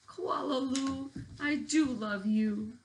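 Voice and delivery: loving mother. Acted. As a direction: say it like a mother soothing her child. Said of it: "her mother had looked at her and said".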